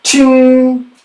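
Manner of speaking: sharp, high, loud metallic ching; high ringing ching of a blade. Acted. Narrator voicing the sword's ring mid-fight, high and loud. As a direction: ring it out like steel striking. Sword sound in combat scene.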